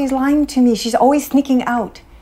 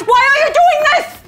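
angry scolding; 5 dB louder than usual. Acted. Mother scolding daughter caught drinking.

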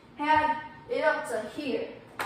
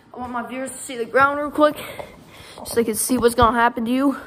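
louder, brash showman boast to the viewers; loud trash talk; 6 dB louder than usual. Acted. Streamer boasting to viewers while beating the opponent, loud and brash. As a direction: play it up big for the audience.

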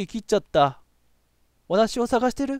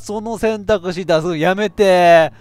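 loud, much higher wail of dismay; dismayed shout; 5 semitones higher, 9 dB louder, slower than usual. Real, not acted. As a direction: cry out then plead slowly. Dreaded choice appears.

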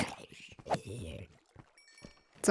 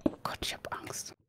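scared whisper; 16 dB quieter than usual. Real, not acted. Scared whisper in dark cave.

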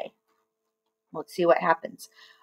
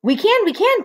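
high, louder insistent cry; eager cry; 12 semitones higher, 5 dB louder than usual. Acted. Mugglewump answering the doubting bird, eager cry. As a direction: insist with rising excitement. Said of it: "cried Mugglewump".

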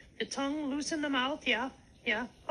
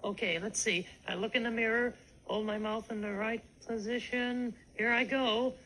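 ordinary talk

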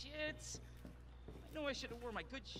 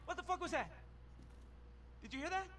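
jumpy, scared of a noise; startled panic; 11 semitones higher than usual. Acted. Game character hears a noise.

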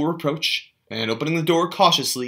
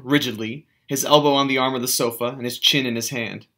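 ordinary delivery

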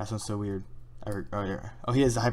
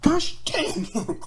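shocked yelp; 5 dB louder than usual. Real, not acted. High yelp at dying.

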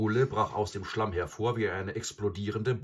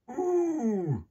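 loud, high buffalo hoot; drawn-out high "ooh" of a wild buffalo; buffalo noise; 5 dB louder than usual. A narrator's reading. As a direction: bellow a high animal moo. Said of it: "machte Mysa, der wilde Büffel".

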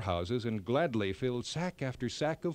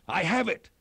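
higher, louder sudden discovery; cried out; 5 semitones higher, 5 dB louder than usual. A narrator's reading. King Brinna hits on the answer, crying out louder and higher. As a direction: burst out with the idea. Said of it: "Then he cried".

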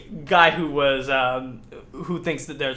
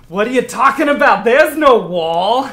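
high, loud, incredulous yell; disbelieving enemy shout; 8 semitones higher, 13 dB louder than usual. Acted. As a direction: shout down your foolish crewmate. Game master voices disbelieving enemy.

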